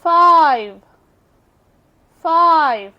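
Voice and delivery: Five said loud with a long vowel; word demo. Shown as a demonstration. Teacher to students, modelling five loudly. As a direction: model five firmly twice. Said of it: "long sound देंगे".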